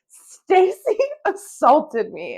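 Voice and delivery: loud, high, very slow proclamation; mock-outraged headline, stretched and loud; all big letters. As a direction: blare each word like giant capital letters. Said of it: "in all big letters".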